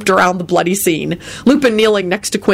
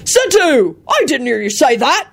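bellowed; 7 semitones higher than usual. A narrator's reading. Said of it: "Hagrid bellowed back".

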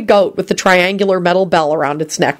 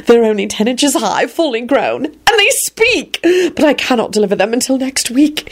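excited whisper; 6 semitones higher than usual. A narrator's reading. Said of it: "he whispered excitedly".

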